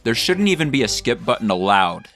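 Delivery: ordinary talk, nothing marked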